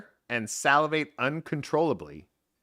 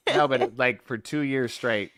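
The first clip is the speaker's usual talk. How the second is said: ordinary delivery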